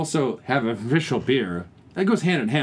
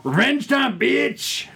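high, gloating battle cry; mock triumphant yell; 12 semitones higher, much slower than usual. Co-host playing the French taking revenge, mock triumphant yell. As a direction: holler it as hammed-up payback.

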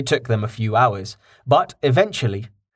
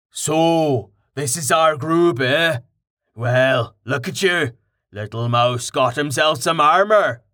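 a hint of a big hearty warrior, teasing; Carten, jovial; 3 semitones higher than usual. A narrator's reading. Big warrior Carten teases Rain about his armor, jovial. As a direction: boom it out like a friendly brute.

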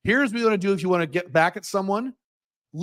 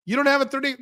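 tourist asking; 8 semitones higher, faster than usual. Retold or imitated. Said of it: "And he's like".

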